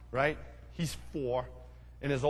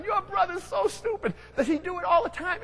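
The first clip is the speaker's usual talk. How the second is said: much higher, mocking kid voice; mocking teen friends; 14 semitones higher, 3 dB louder than usual. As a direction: jeer like a giggly teenager. Friends laughing at Bobby.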